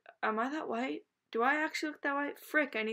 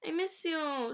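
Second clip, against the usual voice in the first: ordinary delivery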